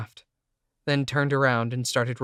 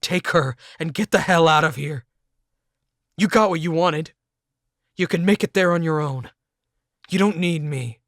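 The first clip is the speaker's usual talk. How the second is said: loud, higher angry yelling; furious shout; 4 semitones higher, 7 dB louder than usual. A narrator's reading. Narrator roaring at Jake to take her and leave. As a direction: drive them out with a roar.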